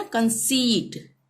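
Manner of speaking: कंसीट with a long stretched ई; teacher's model कंसीट, ई held long; drawn-out ee. Shown as a demonstration. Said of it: "ई हम खींच रहे हैं ना".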